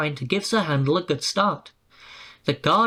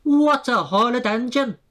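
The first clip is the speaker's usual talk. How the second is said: high, indignant coach chorus voice; sniffy coaches scolding the engine; outraged coaches; 8 semitones higher, 3 dB louder than usual. A narrator's reading. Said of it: "screamed Agnes, Ruth, Lucy".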